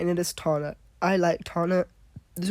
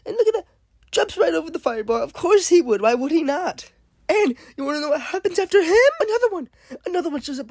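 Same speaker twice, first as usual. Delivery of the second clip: exasperated; 12 semitones higher, 6 dB louder than usual. Real, not acted. Zombies keep coming, exasperated.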